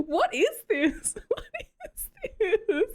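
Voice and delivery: high, incredulous question breaking into laughter; baffled laughter. Retold or imitated. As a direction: ask it while cracking up. Cracking up, reliving her bafflement.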